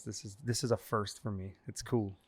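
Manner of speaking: ordinary delivery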